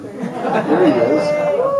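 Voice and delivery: very high, slow drawn-out hype; mock announcer. Comic hyping Bernie to the crowd, very high and slow. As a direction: stretch it way up like a game-show reveal.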